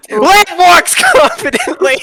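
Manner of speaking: high, breaking into laughter; laughing. Real, not acted. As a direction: say it while losing it laughing. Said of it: "Oh, I'm crying".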